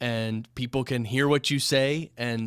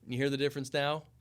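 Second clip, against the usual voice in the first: far and much softer, off-mic; 9 dB quieter than usual. Shown as a demonstration. Host contrasts close and distant mic. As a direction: pull back from the mic. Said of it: "You hear that difference?".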